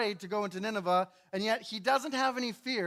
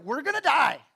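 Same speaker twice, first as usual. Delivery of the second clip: mock panic; 6 semitones higher, 9 dB louder than usual. Sailors panicking in storm.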